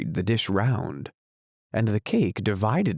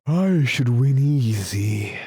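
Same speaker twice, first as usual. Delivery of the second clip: slow, drawled-out lion voice, raised a little; boastful lion; 4 semitones higher, 4 dB louder, slower than usual. A narrator's reading. Lion boasting to the unicorn about the fight, slow and boastful. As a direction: brag it slowly as a big lazy lion.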